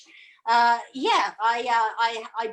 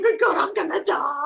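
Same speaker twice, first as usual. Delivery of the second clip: raised, exaggerated wail; mock despair; 5 semitones higher than usual. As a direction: complain as if dying of cold, hammed up. Retells freezing in three jackets.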